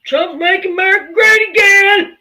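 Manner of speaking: hammed-up shouted slogan; theatrical slogan shout. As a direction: bellow it like an overblown rally cry. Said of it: "Do you like my theatrics?".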